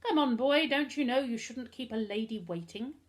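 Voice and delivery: put-on high, loud opening in a French accent, easing down. A narrator's reading. Butler hurries boy to the waiting lady, French accent. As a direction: chivvy him along as a French-accented butler. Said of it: "It was a French accent".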